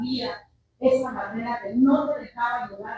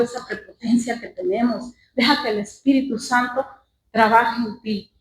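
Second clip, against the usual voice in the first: louder preaching exhortation; 5 dB louder than usual. Acted. Preacher urging congregation to forgive, louder. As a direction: urge the church to forgive.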